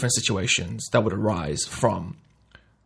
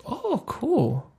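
mock eager; 7 semitones higher than usual. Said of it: "And you're like".

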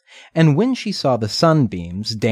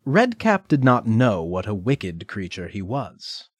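ordinary delivery

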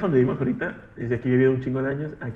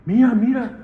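high, louder urgent call to look; imitated startled woman; 9 semitones higher, 6 dB louder than usual. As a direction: imitate a woman calling out at a strange sight. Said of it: "Ajá, y dice". (voz aguda y fuerte de señora imitada)

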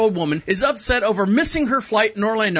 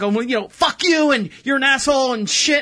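higher, heated retold insult; retold indignant cursing; mock angry outburst; 5 semitones higher than usual. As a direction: imitate an offended commenter lashing out. Retelling the commenter's abusive reply.

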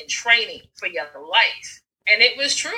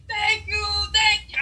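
higher, light voice for the grateful rider; grateful cyclist; 12 semitones higher than usual. Retold or imitated. Imitating the neighbor on the bike thanking him, lightly higher. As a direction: mimic her breathless thanks. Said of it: "She was like".

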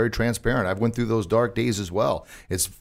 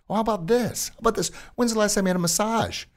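mentor voice; 11 semitones higher than usual. Retold or imitated. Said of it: "And he says".

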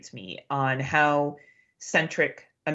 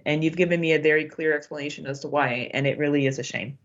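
ordinary talk, nothing marked

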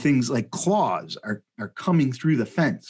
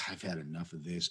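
soft, low, quick tiger grumble; a tiger done with it, muttered low; prodded tiger giving up, mock low grumble; fed-up tiger; 6 semitones lower, 16 dB quieter, faster than usual.

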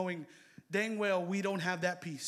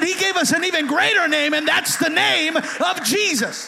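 much louder, higher repeated cry; preacher repeating it at the top of his voice; preaching at full force; 9 semitones higher, 14 dB louder than usual. Acted. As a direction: shout it again to lift the room. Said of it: "I said".

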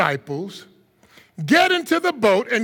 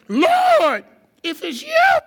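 eager Peter; 14 semitones higher, slower than usual. Acted. Peter calls out doubtfully.

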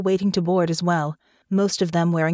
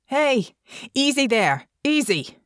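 high, firm command to back off; sharp and commanding; 7 semitones higher, slower than usual. A narrator's reading. Russo ordering the police to let go, sharp. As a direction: take charge of the police. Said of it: "her voice came out sharp and commanding".

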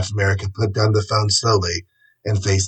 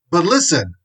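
high, protesting producer; indignant cry of protest; angry TV producer; 8 semitones higher, 3 dB louder than usual. A narrator's reading. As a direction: protest in a raised, agitated pitch. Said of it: "running forward angrily".

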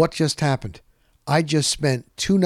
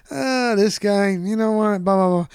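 Joe Maddon; 6 semitones higher than usual. Retold or imitated. Podcaster imitating his friend griping at the All-Star game, lightly voiced. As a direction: rattle on in a lightly imitated higher voice. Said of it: "then Joe be like".

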